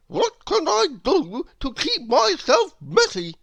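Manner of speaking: high, loud, odd character voice for a messy little man; Mister Messy voice. A narrator's reading. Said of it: "he asked".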